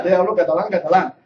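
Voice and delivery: ordinary delivery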